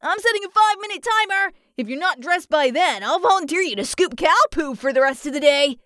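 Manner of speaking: fast, high-pitched warning; rapid, peppy drill-like ordering; bossy ultimatum. A narrator's reading. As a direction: rattle off the threat briskly. Alex threatens the dawdling cats.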